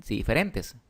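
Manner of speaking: ordinary talk, nothing marked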